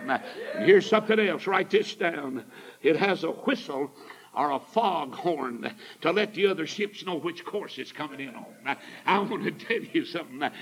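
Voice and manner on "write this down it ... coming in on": normal talking voice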